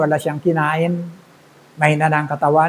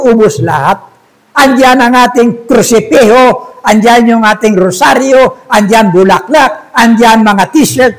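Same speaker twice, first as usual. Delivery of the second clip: higher, eager broken-Tagalog shop owner; Chinese shopkeeper; 7 semitones higher, 6 dB louder than usual. Acted. Chinese owner telling the robber how busy the shop is now, excited accent. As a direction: play the cheerful Chinese owner.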